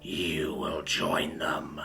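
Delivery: slow menacing whisper. A narrator's reading. Villain to intruders, a slow whispered threat. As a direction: breathe the threat, slow and voiceless. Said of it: "he whispered".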